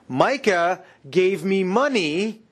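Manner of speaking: slow, drawn-out boast with long pauses; lightly mocked self-important priest; telegraphing Levite. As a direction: spell it out slowly, showing what kind of man he is. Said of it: "telegraphs what kind of person he is".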